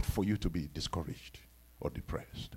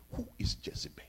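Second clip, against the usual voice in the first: whisper; 9 semitones lower, 11 dB quieter than usual. Acted. Preacher drops to a hushed question.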